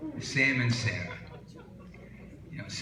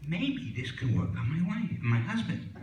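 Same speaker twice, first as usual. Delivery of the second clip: wife's hopeful thought; 8 semitones higher than usual. Acted. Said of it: "she's thinking".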